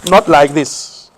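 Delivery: slow deliberate not like this; slow and firm. Shown as a demonstration. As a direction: spell it out slowly to the class. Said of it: "Your palms".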